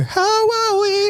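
"How are we?" sung high and slow in mock Indian accent. Shown as a demonstration. Kian shows the line he meant in mock Indian accent. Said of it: "I was meant to go".

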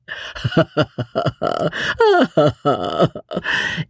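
long, high peals of laughter; long merry laugh. A narrator's reading. Wife laughing at husband's fear, long and merry. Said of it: "long and merry laugh that went echoing".

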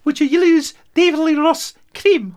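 high excited squeal. Real, not acted. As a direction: blurt it out, thrilled and high.

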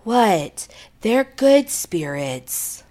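slow eerie possessed voice; creepy movie person. Retold or imitated. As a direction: drag it out like a horror film mother. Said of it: "like a creepy person in the movie".

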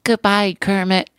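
lightly voiced giant puppet bird; Big Bird. Shown as a demonstration. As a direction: demonstrate a Big Bird goodbye. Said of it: "What's Big Bird sound like?".

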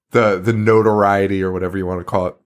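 ordinary delivery

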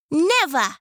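high firm tortoise no; high tortoise with a mild sting; tortoise refusing. Acted.

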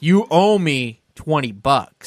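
slow, measured hypnotic insistence; mock mind-control delivery; Jedi mind trick. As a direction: say it slowly, as if planting the thought. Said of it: "his Jedi mind tricks on me".

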